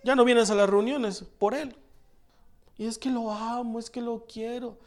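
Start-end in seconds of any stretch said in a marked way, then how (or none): 2.8-4.8 soft, breathy-free hushed imitation of a smitten teenager; quiet mock teen girl pleading; lovesick teen girl. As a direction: lightly mimic a dreamy fifteen-year-old, much softer. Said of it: "venían señoritas y me pedían consejo".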